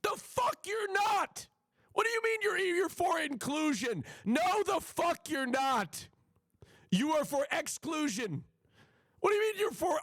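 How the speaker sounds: high-pitched, incredulous screech; outraged yelling. Real, not acted. Outraged outburst at the played clip.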